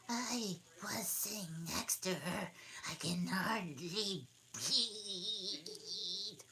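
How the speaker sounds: high breathy whisper; whispering dormouse. Acted. Dormouse squeezed beside Alice, high whisper. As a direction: hush it like a cramped little creature. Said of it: "said the dormouse".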